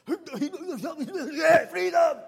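big Scottish battle-cry yell, very high and loud with a laugh; excited Scottish roar. Retold or imitated. Preacher shows how an excited Scot sounds, Scottish freedom roar. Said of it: "it sounds pretty much like this".

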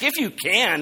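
high-pitched, scoffing echo of a doubter's words; incredulous echo. Retold or imitated. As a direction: toss it back as if absurd. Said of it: "It's not like "if you," it's, it's like".

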